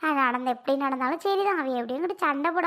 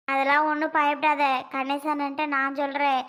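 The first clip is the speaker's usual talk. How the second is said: ordinary talk, nothing marked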